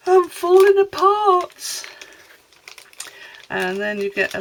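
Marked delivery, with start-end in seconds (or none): 0.1-1.9 higher, louder theatrical lament; mock wail. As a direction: wail it like a tragic heroine. Joking about her aches.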